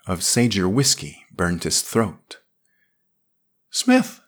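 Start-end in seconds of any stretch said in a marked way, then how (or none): 3.7-4.2 high, loud calling voice of Yarrell. A narrator's reading. Yarrell calling to the fallen Smith, high and urgent. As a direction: call him back from far away, high. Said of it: "Yarrell's voice was saying from far away".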